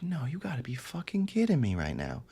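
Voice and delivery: soft, breathy-feeling whisper of shock; hushed disbelief. Retold or imitated. On the phone with his mom, heroin in hand, a hushed disbelief. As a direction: say it almost to yourself. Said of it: "I'm like".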